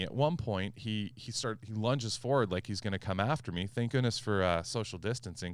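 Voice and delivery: ordinary delivery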